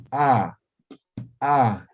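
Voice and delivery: "a" popped out hard and loud; explosive pa. Shown as a demonstration. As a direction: make the "a" burst like a small explosion. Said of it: "La explosiva, haciendo explotar". (explosiva, haciendo explotar el sonido)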